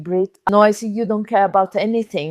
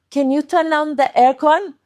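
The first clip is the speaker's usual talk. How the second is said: strained request; 6 semitones higher than usual. Shown as a demonstration. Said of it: "With the face a little bit like this".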